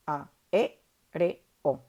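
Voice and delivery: "A, E, R, O" as four separate syllables, a pause between. Shown as a demonstration. Teacher showing the four syllables of aéreo, "A, E, R, O" syllable by syllable. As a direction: split "A, E, R, O" into distinct beats. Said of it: "Tiene cuatro sílabas".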